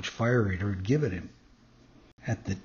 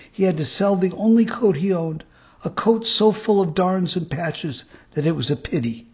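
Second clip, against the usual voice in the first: higher, louder earnest Pinocchio voice; eager young puppet; 5 semitones higher, 4 dB louder than usual. A narrator's reading. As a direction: plead it earnestly in a raised puppet voice.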